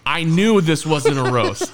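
ordinary talk

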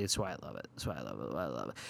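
self-echo fading to a murmur. Acted. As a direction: let it die away like an echo. Fading echo of own phrase.

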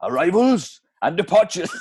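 lilting Welsh advert voice; Welsh accent. Acted. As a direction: Plainview with a Welsh lilt. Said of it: "that Welsh accent".

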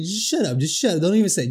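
mock scolding. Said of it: "She's just".

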